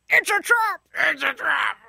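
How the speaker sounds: high gurgly squid voice, shouted; Admiral Akbar voice. Pretend. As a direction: shriek it like a squid alien. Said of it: "Do it".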